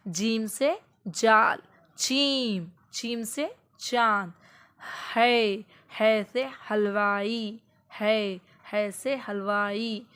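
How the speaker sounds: slow, clearly voiced letter chant; slow clear alphabet chant for a child; slow clear drill. Shown as a demonstration. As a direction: let every sound reach the child's ears. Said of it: "सही आवाज़ बच्चे के कानों तक पहुँचे".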